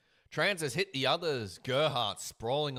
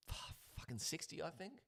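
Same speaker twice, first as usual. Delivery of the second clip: muttered aside; 3 semitones higher, 14 dB quieter, faster than usual. Real, not acted. Aside to himself about the year.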